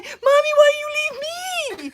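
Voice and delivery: high, loud wailing shout; hammed-up sobbing scream for mommy; mock crying yell. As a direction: wail after her like a lost child. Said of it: "she's behind me yelling".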